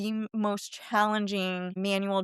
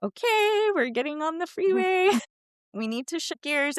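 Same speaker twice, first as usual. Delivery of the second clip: higher, lightly voiced dad, half laughing; dad coaching; 7 semitones higher than usual. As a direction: play the dad gently nudging his kid onto the freeway. Dad voiced guiding nervous teen.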